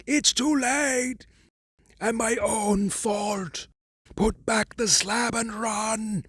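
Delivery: high, desperate cry of despair; despairing scream. A narrator's reading. Warren screaming up from the tomb, despairing. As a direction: beg him to flee, utterly despairing. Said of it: "scream of utter despair".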